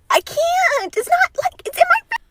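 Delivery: high, strained, close to tears; tearful pleading. Real, not acted. Woman on video breaking down over the election, high and tearful. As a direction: squeeze it out in a high, tight wail.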